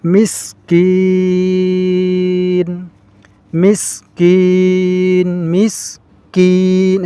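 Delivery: মিসকিন with the কিন drawn out slowly; lengthened vowel. Shown as a demonstration. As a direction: hold the কিন of মিসকিন long. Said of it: "এই কিন এখানে আমাদেরকে টান দিতে হবে".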